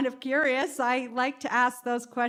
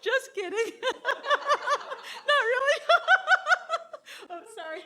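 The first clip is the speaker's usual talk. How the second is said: laughing; 12 semitones higher than usual. Real, not acted. Teasing after just kidding.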